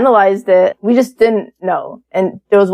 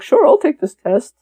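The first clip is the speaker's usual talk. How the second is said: imitated dad, higher Russian-accented voice; 7 semitones higher than usual. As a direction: put on dad's Russian accent. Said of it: "in a Russian accent".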